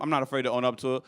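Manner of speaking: normal talking voice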